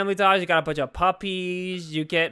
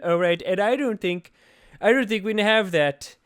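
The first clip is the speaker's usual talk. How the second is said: higher-pitched ranting politician impression; Donald Trump; 3 semitones higher than usual. Blaming chihuahuas for the vampires, Trump impression. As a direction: rant emphatically in a presidential impression. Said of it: "I think that Trump impression".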